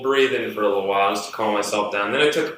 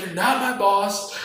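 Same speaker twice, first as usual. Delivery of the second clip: high, slow re-enacted defiance; retold indignant comeback; 10 semitones higher than usual. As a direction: stand up to her, drawn out and high. Re-enacts his angry comeback.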